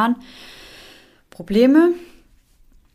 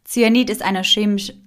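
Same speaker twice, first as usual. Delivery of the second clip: normal talking voice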